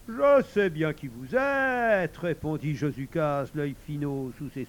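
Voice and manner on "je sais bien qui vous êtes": high, drawn-out, slyly knowing; knowing reply. A narrator's reading. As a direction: stretch it out like a crafty host. Sly host answers the stranger. (réplique finaude, aiguë et traînante)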